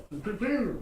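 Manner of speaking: high, rolling duck-call noise; quick trilled call, falling at the end; imitated duck call. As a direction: mimic a hunter's duck call. Imitates a duck call in hunting story.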